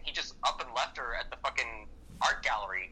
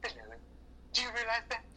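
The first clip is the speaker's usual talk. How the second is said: sneering put-down, softer, then jumping higher; mock condescending boss; 3 semitones higher, 5 dB quieter than usual. Mimicking the boss belittling his secretary Alba, sneering. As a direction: belittle her like a smug boss. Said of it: "It's like".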